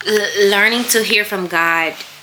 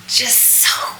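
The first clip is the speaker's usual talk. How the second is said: whispered, softer, slow with emotion; almost breaking, barely voiced; tearful whisper; 6 dB quieter, slower than usual. Real, not acted. Missing her peace with God, whispering through tears. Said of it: "I'm getting emotional about it".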